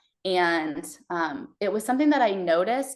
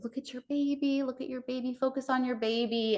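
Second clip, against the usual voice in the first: higher, softer coaxing chant; coaxing nurses; 6 semitones higher, 5 dB quieter than usual. Retold or imitated. Nurses urging the mother during painful stitching, soft coaxing. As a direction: gently pull her focus to the baby.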